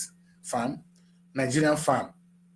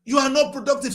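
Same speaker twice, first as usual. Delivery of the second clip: louder, higher, slowing to a hammered insult; angry shout; 6 semitones higher, 6 dB louder than usual. Real, not acted. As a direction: shout them down and spell out each word of the insult. Speaker scolds politicians, shouting.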